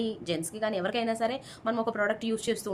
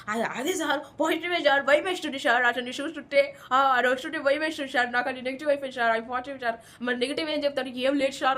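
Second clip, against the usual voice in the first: imitating Suman Shetty. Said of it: "ఏమంటారు సుమన్ శెట్టి".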